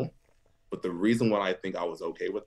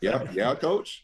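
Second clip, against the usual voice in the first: high, nervous yeah coach; nervous yes coach; 6 semitones higher, much slower than usual. Retold or imitated. Nervously answering his silent coach, high squeak.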